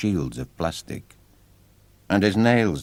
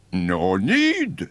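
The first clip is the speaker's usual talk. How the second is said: clown voice; 3 semitones higher than usual. Acted. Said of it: "said the blue clown".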